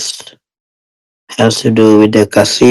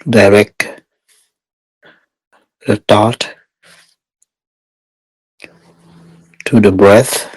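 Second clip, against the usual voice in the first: guided meditation. Real, not acted. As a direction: guide meditators step by step, unhurried and even.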